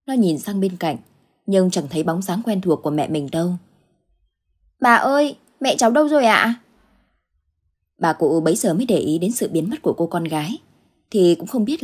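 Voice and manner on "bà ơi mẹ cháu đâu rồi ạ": put-on high, childlike question to grandma; little girl voice. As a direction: play a small child, voice lifted high. (giọng bé gái cao, hỏi bà)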